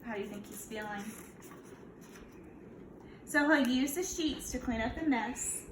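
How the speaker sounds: ordinary delivery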